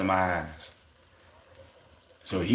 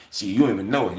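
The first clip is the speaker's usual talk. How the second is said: ordinary talk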